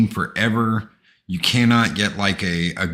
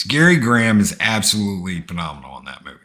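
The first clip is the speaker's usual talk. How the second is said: usual speaking voice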